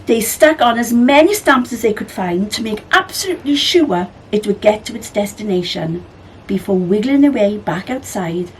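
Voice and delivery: ordinary delivery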